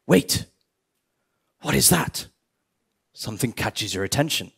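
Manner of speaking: normal talking voice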